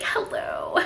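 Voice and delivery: low, soft hello through gritted teeth; gritted-teeth greeting. Retold or imitated. Nanny greets the bratty girl, through gritted teeth. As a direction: force out a polite hello, mock-strained. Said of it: "she just gritted her teeth".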